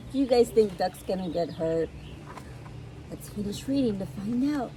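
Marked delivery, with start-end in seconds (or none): none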